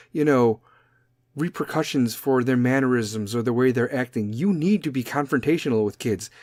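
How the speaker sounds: usual speaking voice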